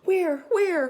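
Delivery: high, loud panicked cry while running; frantic cry. A narrator's reading. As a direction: yell it in alarm, running. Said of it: "cried Pindooley as she raced".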